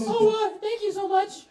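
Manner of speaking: put-on high, loud girly voice; anime girl voice. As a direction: squeal it like an excited anime girl. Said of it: "Gotta put on my female character".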